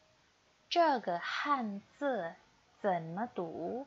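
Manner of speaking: slow, clear Mandarin, each syllable spaced for repeating; slow model reading. Shown as a demonstration. Teacher modeling for students to repeat, slow and clear. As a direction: read it slowly so students can copy. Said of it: "Ikuti Lao She ya".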